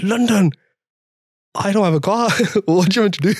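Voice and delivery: high-pitched, incredulous retold reaction; surprised. Contestant told he must come to London, incredulous. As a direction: replay being taken aback, pitch leaping up.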